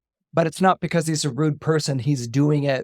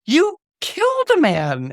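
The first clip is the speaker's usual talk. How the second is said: accusing; 12 semitones higher, 5 dB louder than usual. Retold or imitated. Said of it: "she's like".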